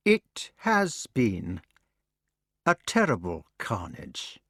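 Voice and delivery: very slow, mechanical echo; sick son echoing the king mechanically; automaton voice. Acted. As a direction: repeat it like a machine with hidden meaning. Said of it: "The voice was that of an automaton, but deep with meaning".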